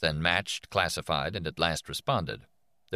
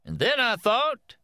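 high, slow, friendly folksy teacher; affable teacher voice; 6 dB louder, slower than usual. A narrator's reading. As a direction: chat with the kids, easygoing. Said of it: "in an affable, informal voice".

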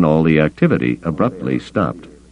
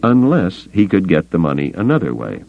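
ordinary delivery

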